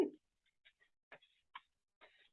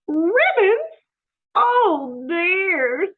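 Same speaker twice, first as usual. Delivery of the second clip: high, loud hammed-up dismay; mock despair at the ribboned steak; dismayed Missus Rogers; 13 semitones higher, 9 dB louder, much slower than usual.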